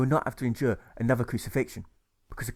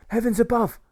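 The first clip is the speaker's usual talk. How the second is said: high, loud shriek of alarm; shrill shout; 11 semitones higher, 7 dB louder than usual. Acted. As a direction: cry it out to the heavens, high and loud. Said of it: "he shouts out with shrillness".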